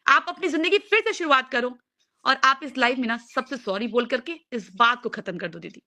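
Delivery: ordinary talk, nothing marked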